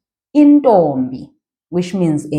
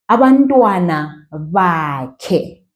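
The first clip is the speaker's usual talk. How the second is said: "Abantwana bakhe" stretched out slowly; teacher drawing out the phrase; slow demo; much slower than usual. Shown as a demonstration. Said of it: "say every syllable".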